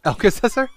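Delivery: Alka-Seltzer said as Elka-Sessa, high and loud rising; Alka-Seltzer squeaked high with the t dropped; mispronounced Alka-Seltzer. Real, not acted. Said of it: "You still didn't get it right".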